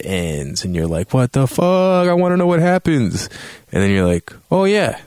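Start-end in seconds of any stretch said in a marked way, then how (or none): 1.1-3.3 high, exasperated disbelief; frustrated outburst. Retold or imitated. Player reacting to cliffhanger ending. 4.5-5.0 high, eager excitement; light up at the idea; excited realisation. Retold or imitated. Player realising he can continue.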